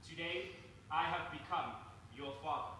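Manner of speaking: ordinary talk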